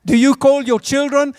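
normal talking voice

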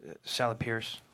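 hushed phone voice. Acted. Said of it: "speaks in hushed tones".

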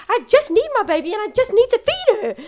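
loud, high-pitched frantic pleading; retold outburst. Mother begging to feed her baby, retold frantic wail. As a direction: act out a desperate wail for the baby. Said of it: "I lost it even more".

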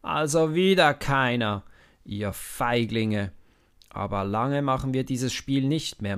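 loud, scornful giant's roar; mocking giant. A narrator's reading. Goliath mocking the Israelites again, loud and scornful. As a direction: scorn them from the riverbed, booming. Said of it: "Jetzt lacht er".

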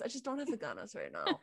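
soft laughing spanglish line; laughing softly. Real, not acted. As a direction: say it with a quiet laugh. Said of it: "I love it".

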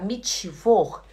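"Mittwoch" as "mitchivor"; wrong chi pronunciation. Shown as a demonstration. Teacher showing the habit to drop, "Mittwoch" with chi. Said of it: "Em vez de falar".

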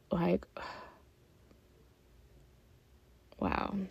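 breathy sigh, then a hushed, unvoiced "wow"; overcome, barely getting the words out; choked-up whispered aside; emotional whisper. Real, not acted. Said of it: "I don't know why I'm getting emotional".

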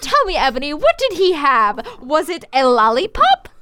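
high, eager, hammed-up begging; mock pleading. Reader begging Ebony for the missing detail, mock-eager pleading. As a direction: beg playfully to be told.

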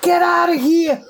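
very high, loud imitation of an excited woman; hammed-up high-pitched cheer, stretched out at the end; cheering young woman. Young woman congratulating a stranger on quitting, mimicked high and loud. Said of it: "She was like".

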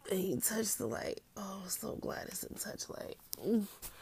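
softly whispered relief; whispered self-talk. Real, not acted. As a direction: breathe it out barely voiced. Sick, talking herself through.

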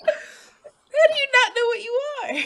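high, loud, drawn-out incredulous question; laughing disbelief. Real, not acted. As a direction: ask it cracking up, incredulous. Laughing disbelief at friend's answer.

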